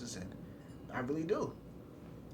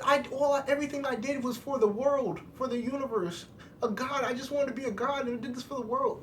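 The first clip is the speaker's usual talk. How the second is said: higher, whiny mock-sobbing villain; mock crying Zamasu; 9 semitones higher than usual. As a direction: play the weeping villain, tongue in cheek, pitched up. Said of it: "Zamasu was crying".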